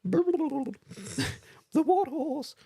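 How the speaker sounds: very high, slow falsetto creature voice. Pretend. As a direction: stretch it out high like a squeaky beast. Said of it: "Looks like a Kelpie".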